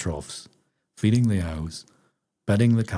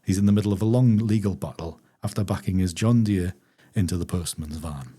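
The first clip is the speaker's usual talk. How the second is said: ordinary delivery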